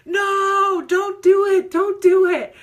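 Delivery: very high, very loud pleading no; a frightened body begging you to stop; imitating her own panic, shrill and loud; panicked inner voice. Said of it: "my body starts to go".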